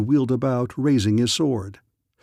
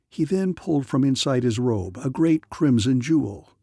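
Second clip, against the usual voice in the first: ordinary delivery, nothing marked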